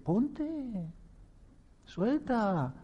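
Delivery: high, soft mock "ponte, suelta"; mock repeating. A doubter mocking the teacher's advice, high mock voice.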